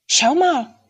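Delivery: high, loud, surprised exclamation; surprised call. A narrator's reading. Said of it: "rief er ganz überrascht". (überrascht rufend, hoch und laut)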